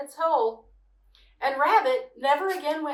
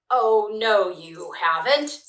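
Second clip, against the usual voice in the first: growled; 3 semitones lower, 8 dB louder, slower than usual. A narrator's reading. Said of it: "Tiger growled".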